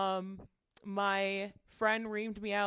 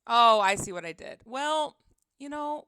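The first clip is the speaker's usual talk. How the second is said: normal talking voice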